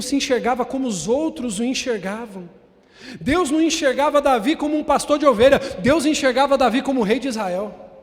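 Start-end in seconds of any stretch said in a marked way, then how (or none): 3.2-7.9 loud, high preaching build; preaching at full force. Acted. Pastor preaching loud to the church, full force. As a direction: preach it out to the congregation.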